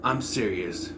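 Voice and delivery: normal talking voice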